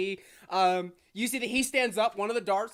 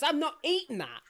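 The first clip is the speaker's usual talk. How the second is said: higher-pitched acted protest; indignant refusal; 6 semitones higher than usual. Brother turning down the rock-hard cookie, indignant refusal. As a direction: refuse it in pitched-up mock outrage. Refusal repeated as argument heats up.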